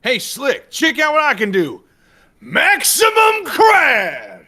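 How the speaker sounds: high, slow, drawn-out shout; character shout. Acted. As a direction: belt it out like a cartoon crab boss. S3 acts out a game voice line.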